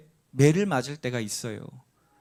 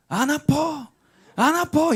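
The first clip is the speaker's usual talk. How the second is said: put-on high, loud child yelling it doesn't hurt; defiant child shout; 12 semitones higher, 4 dB louder, slower than usual. Father mimicking his son yelling defiantly at each smack. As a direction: shout back like a stubborn little boy who won't give in. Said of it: "맞을 때마다".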